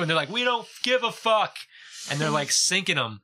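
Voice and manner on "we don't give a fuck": high, dismissive mock voice; mock dismissal. Rich boaters snubbing their supporters, mock dismissal. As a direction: brush them off in a raised, mocking pitch.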